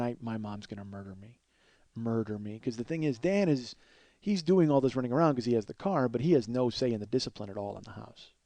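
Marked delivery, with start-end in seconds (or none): none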